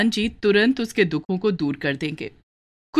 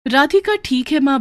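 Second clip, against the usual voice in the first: put-on higher child's voice, gently coaxing; a young girl soothing her crying mother; little daughter consoling; 6 semitones higher, 4 dB louder than usual. Daughter agrees and leaves.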